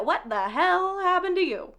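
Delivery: high, incredulous retold question; mock shocked. Friends seeing her covered in grease, mock shocked. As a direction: pitch it up in acted disbelief.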